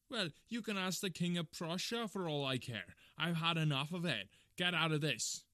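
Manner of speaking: loud, high, fed-up outburst; furious, exasperated dismissal; angry shouting. Acted. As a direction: shout him off the premises. Angry salesman sends man away.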